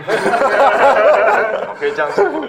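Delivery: bursting into high laughter; laughing. Real, not acted. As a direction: laugh through the words. Laughing at the joke.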